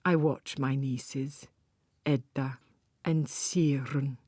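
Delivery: usual speaking voice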